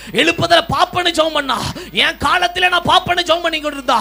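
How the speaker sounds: loud, rising preaching that builds; fiery preaching. Acted. Preacher to the church about Anna's prayer, loud rising fervour. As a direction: preach it at full force.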